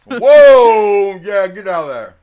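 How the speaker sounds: shocked. Real, not acted. Shocked by the food picture.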